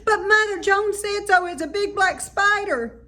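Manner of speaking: put-on high, loud child squeal. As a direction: squeal it up and down with excitement.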